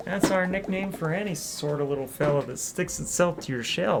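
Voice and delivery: southern hostess greeting; southern accent. Acted. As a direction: welcome the newcomer with a southern lilt. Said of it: "a southern accent in this game".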